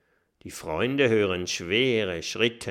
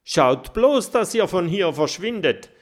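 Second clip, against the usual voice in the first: much higher, louder urgent warning; mole voice; 8 semitones higher, 8 dB louder than usual. Acted. Mole warning the friends to leave quickly. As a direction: warn them off, urgent little mole.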